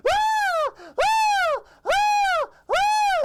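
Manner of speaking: piercing, very high loud shriek; cartoon scream. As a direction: scream at full pitch like a terrified cartoon. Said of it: "Sponge screams".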